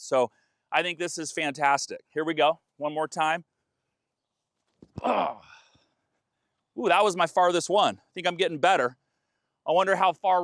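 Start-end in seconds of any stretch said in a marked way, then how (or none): 5.0-5.4 short grunt on the throw; effort grunt. Real, not acted. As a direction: push out a grunt while throwing. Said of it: "Sorry for the grunting".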